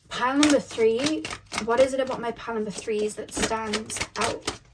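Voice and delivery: usual speaking voice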